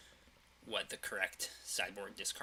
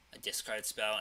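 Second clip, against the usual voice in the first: ordinary talk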